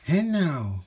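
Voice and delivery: high, loud rallying call; cry out. A narrator's reading. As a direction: call it out to the whole crowd. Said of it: "cried Max".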